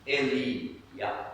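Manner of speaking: "Eli-yah" said as eh-lee-YAH; two parts, "Eli" then "yah"; Hebrew pronunciation. Shown as a demonstration. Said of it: "It's an acronym".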